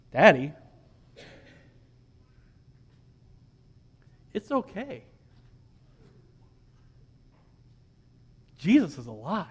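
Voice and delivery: a hushed, lightly voiced little girl; child's reassurance, softly. Four-year-old daughter comforting her dad, soft and hushed. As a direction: gently tell Daddy it's okay. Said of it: "She says".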